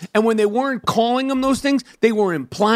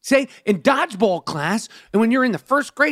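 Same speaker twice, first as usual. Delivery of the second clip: usual speaking voice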